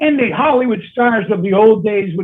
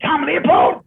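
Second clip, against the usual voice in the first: [name]; 5 semitones higher, slower than usual. Retold or imitated. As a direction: call out the name in a raised, put-on voice. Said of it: "I hear this".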